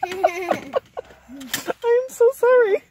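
high, laughing sorry; laughing apology. Real, not acted. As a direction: apologize through giggles. Mom apologizing while laughing.

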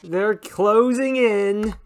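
slow, teasing countdown; gloating host. Acted. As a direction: savour the suspense like a showman. Mettaton counting down flames.

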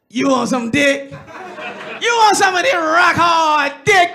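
slow, loud, leering pitch; slow come-on, drawn out and loud; seductive mock Chris Rock. Imagined Chris Rock hitting on his wife, slow and loud.

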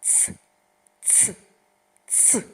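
"ц" as a short voiceless hiss through the teeth; repeated crisp "ц" sound; sound demo. Shown as a demonstration. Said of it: "Вимовляємо звук".

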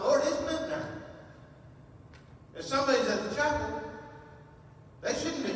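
high, incredulous objection; exasperated protest. Retold or imitated. Soldier objecting to the Lord about the midnight walk, high exasperated protest.

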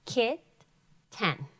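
Kit, ten with the pitch dropping on ten; split syllables. Shown as a demonstration. Teacher splitting kitten again for the class. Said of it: "In order to have that I sound".

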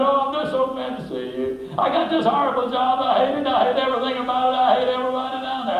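high, pained rant of a miserable employee; upset woman near tears. Retold or imitated. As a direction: voice her as if she's about to cry, gushing out complaints. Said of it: "She almost started crying when she saw me".

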